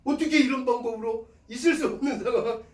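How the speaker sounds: normal talking voice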